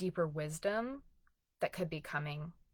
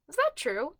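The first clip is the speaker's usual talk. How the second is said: higher, pointed questioning of the critic; mock questioning; 9 semitones higher than usual. Shown as a demonstration. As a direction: challenge the thought. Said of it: "just ask yourself".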